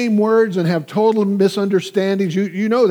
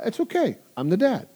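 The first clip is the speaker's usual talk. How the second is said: soothing dad; 4 semitones higher, 4 dB quieter than usual. Retold or imitated. Dad soothing daughter again.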